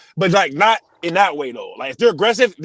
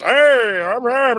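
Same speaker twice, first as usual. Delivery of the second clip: bright, higher cheerful greeting; 6 semitones higher, much slower than usual. Retold or imitated. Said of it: "As, like, just".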